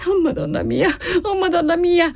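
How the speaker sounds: high, wailing lament; weeping. A narrator's reading. Maid calling on the Madonna, weeping. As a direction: wail it in a high voice. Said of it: "continuava a piangere Margherita".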